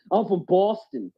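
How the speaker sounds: slow, laughing put-on Boston accent; mock Boston accent. Host trying a Boston accent for his cohost, slow and laughing. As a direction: draw out a joking Boston accent while laughing. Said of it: "I can't think of Boston".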